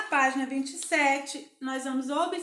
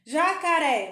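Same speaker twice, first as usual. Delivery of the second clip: "Jacaré" said louder, landing on "ré"; stressed last syllable; 2 semitones higher, 5 dB louder than usual. Shown as a demonstration. Teacher picking out the syllable to colour, stress on "ré". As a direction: punch the final "ré" of "Jacaré". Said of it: "você vai pintar o ré".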